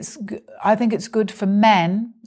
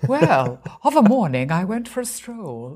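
lightly voiced, old-fashioned formal narrator; a hint of stiff Victorian prose read aloud; mock Dickensian; 3 semitones higher than usual. Showing how her writing comes out, in mock formal Dickensian style.

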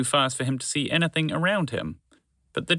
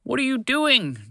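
a much higher, slower alarmed cry; alarmed shout; 10 semitones higher, slower than usual. Acted. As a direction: call out in alarm to stop them. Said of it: "Trevor shouted".

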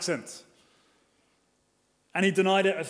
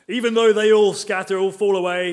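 boastful vow; 3 semitones higher, 9 dB louder than usual. Retold or imitated. Peter vows to Jesus.

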